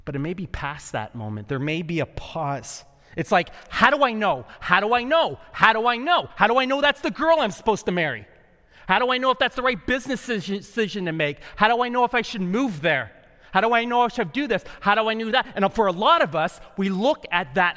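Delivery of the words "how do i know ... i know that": high, loud, insistent repeated questions; anxious pleading questioning. Acted. People asking God how to know, anxious and insistent. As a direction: fire off the questions, pitched up and urgent.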